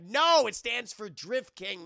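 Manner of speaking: loud, drawn-out exasperated retort; mock-indignant correcting with a stretched NO; exasperated correction. Friend correcting the clueless newcomer about a nickname, exasperated.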